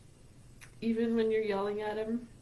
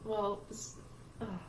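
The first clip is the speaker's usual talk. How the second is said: embarrassed tearful apology; 5 dB quieter than usual. Real, not acted. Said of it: "I'm a blubbering mess".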